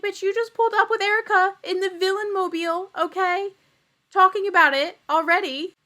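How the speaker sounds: high, sassy clapback in a put-on voice; sassy mock retort. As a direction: snap back at her like a catty reality star.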